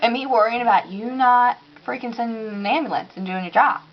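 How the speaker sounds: usual speaking voice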